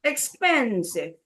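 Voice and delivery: "Expensive" slowed, with PEN drawn out; slow teacher's demo, stressing PEN in "Expensive"; stress on second syllable. Shown as a demonstration. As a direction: hit the middle syllable of "Expensive". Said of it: "So second syllable".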